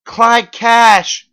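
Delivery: very high, loud scream. Acted. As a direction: scream the name. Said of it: "she screamed".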